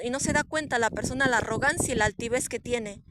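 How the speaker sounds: usual speaking voice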